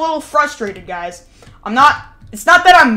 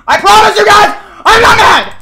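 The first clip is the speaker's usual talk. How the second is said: loud, high-pitched yell; shouted denial; 8 semitones higher, 7 dB louder than usual. Real, not acted. Streamer insisting to chat he is not mad, furious-sounding shout. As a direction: yell the promise, contradicting the calm words.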